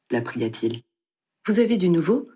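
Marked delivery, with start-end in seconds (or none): 1.5-2.3 a raised, hopeful exclaim; young woman exclaiming at possible news; eager exclamation. A narrator's reading. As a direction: jump on the news. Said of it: "s'exclama-t-elle".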